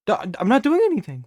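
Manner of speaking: hurried, caught-out insistence; defensive denial. Pretend. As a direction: blurt the denial out quickly. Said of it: "why he's acting so strange".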